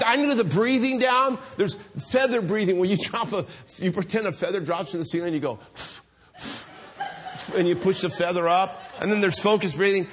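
ordinary talk, nothing marked

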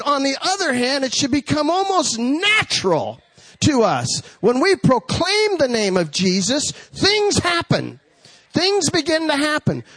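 impassioned pulpit proclamation; fervent preaching. Acted. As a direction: preach it in big rising phrases, stretching the key words. Preaching at full force.

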